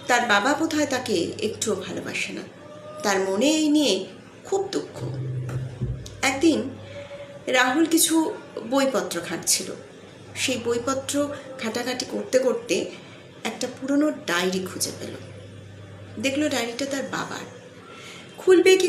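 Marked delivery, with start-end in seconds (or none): none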